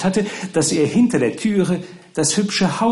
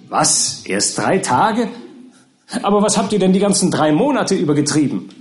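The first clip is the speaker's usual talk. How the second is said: rising disbelief, then a quick higher demand; astonished questioning; 6 semitones higher, 4 dB louder than usual. Acted. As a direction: react amazed and press them for answers. Pickwick astonished at the news.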